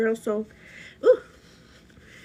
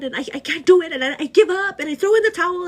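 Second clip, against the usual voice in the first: exasperated giving up; 8 semitones higher, 7 dB louder, faster than usual. Retold or imitated. Voicing a despairing self.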